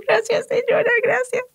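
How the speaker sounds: very high tiny voice of gratitude; squeaky thanks. Retold or imitated. Grateful passenger thanking the gate attendant, very high squeaky voice. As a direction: thank her in a high near-tearful squeak.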